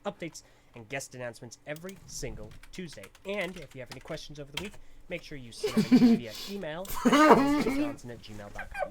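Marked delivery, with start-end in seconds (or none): 5.1-8.9 laughing. Real, not acted. Loud laughing plug.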